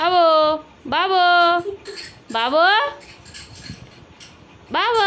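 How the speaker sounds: put-on baby cooing. Calling to the baby.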